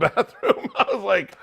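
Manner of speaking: laughing through the punchline. Real, not acted. Comic reaching the bathroom punchline of his story, laughing.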